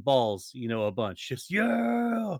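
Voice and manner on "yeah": high, drawn-out yell; mock yell. Podcaster capping his imagined beatdown, yelling. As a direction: let out a long high holler. Acting out the violent attack.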